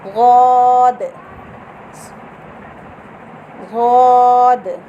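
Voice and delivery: "गौद" thick and heavy, loud and drawn very long; heavy gaud. Shown as a demonstration. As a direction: press the tongue sides to the molars for "गौद". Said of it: "आवाज़ मोटी करनी है।". ("गौद" मोटी आवाज़ में, लंबा)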